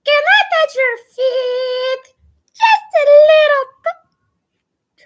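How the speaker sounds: high, loud, squealing plea; Mort from Madagascar. Acted. Streamer as Mort begging to touch feet, high squeal. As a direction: beg in a tiny high cartoon voice, drawing out the end. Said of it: "as Mort from Madagascar".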